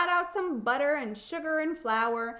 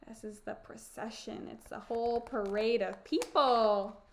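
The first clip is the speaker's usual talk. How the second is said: ordinary delivery, nothing marked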